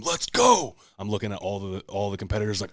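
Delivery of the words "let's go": loud, high victory yell; roaring hype shout; triumphant scream. Retold or imitated. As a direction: belt it out like you just took the lead. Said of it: "I fucking scream".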